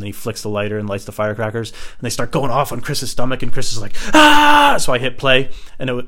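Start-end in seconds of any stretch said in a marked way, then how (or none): 4.1-4.8 high, loud scream of pain; imitated scream. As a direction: scream like firecrackers are burning you. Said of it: "he's screaming".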